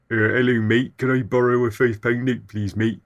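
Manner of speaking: put-on nineties manner of speaking; nineties mate impression. Asking a mate for money, mock nineties voice. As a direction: caricature how people used to ask a favour. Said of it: "In the nineties, we were all like".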